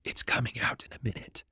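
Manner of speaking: soft breathy secret whisper; whispered aside. Acted. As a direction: lean in and whisper it to a friend. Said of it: "Pippin leaned in to Merry and whispered".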